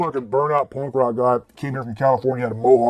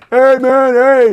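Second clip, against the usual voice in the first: high, loud stoner hail across the street; burnout punk calling out; 12 semitones higher, 7 dB louder, much slower than usual. Retold or imitated. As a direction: holler like a spaced-out punk. Stranger with mohawk calling out.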